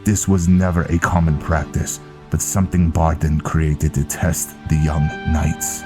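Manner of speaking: Viking recounting a grim past. Acted. Viking reminding Velen of the ambush, solemn storytelling. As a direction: tell the old tale to a comrade, projected.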